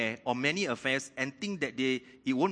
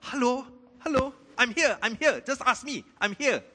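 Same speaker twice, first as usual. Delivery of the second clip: very high, loud pleading call; God calling out; 12 semitones higher, 7 dB louder than usual. Retold or imitated. Preacher voicing God eager to be asked, high pleading call. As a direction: call eagerly, almost crying out. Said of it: "God is like".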